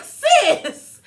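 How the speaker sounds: high, disbelieving "Sis"; incredulous sis. Real, not acted. Vlogger reacting to Erica, high and incredulous. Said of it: "I'm like".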